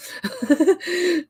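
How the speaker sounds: high giggle; real amused laugh. Real, not acted. Amused by chat comment.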